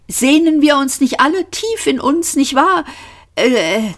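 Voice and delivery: high, anxious appeasing; jittery, ingratiating question; nervous. A narrator's reading. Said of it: "brachte ein nervöses Lachen zustande".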